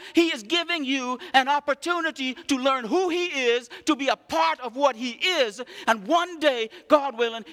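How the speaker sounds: loud, rising pulpit preaching; fired-up preacher building to a shout. Acted. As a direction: preach it out to the whole congregation. Preaches scripture at full force.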